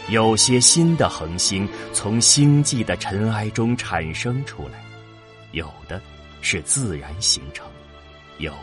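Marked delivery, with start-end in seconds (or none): none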